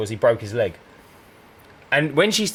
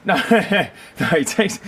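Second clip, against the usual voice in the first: ordinary talk, nothing marked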